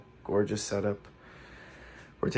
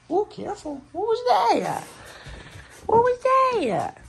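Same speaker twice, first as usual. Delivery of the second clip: high pet voice; 3 dB louder, slower than usual. Real, not acted. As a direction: coo at the pet in a tiny voice.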